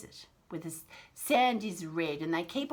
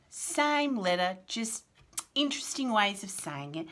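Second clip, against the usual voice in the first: ordinary delivery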